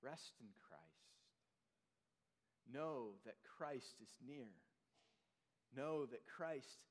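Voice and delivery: whisper-close pastoral calm; hushed reassurance. Real, not acted.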